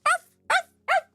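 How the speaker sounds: very high yappy little arf; puppy bark. Retold or imitated. Mocking the xenomorphs as puppy dogs, squeaky yaps. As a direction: yap like a tiny puppy. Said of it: "like puppy dogs".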